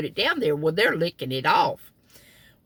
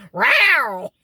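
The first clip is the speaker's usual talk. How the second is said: much higher, louder mock cat yowl; imitating an offended cat's loud yowl; 10 semitones higher, 5 dB louder than usual. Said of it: "he brushed my leg and went".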